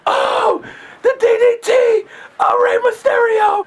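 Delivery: high-pitched hammed-up move calls; mock wrestling hype. Acting like they're wrestling past grandma, hammed-up yelling. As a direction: yell wrestling moves in play.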